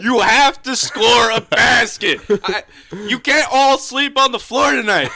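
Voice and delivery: high, loud mock yell. League boss comes down to scold players, mock yelling. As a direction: yell like an exasperated boss, half laughing. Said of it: "just yelling at him".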